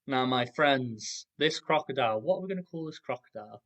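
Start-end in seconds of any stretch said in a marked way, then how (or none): none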